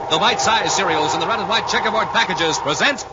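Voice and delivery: big booming radio announcer; announcer intro. Acted. Announcer opening the radio serial, booming and grand. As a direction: sell the show title with a flourish.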